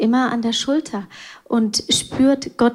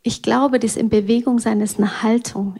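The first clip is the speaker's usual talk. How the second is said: ordinary talk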